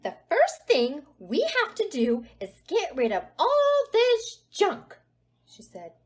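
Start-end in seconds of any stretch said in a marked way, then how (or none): 0.0-5.0 loud, higher-pitched fed-up scolding; angry mother. A narrator's reading. Mama Bear who lost her temper, angry. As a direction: storm in and lay down the law.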